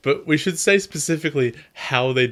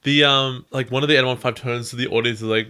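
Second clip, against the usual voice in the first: usual speaking voice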